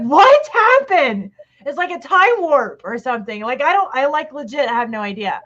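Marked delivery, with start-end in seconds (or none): 0.0-1.3 high, slow, baffled "What happened?"; bewildered. Retold or imitated. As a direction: draw out a dazed question. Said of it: "and it's like".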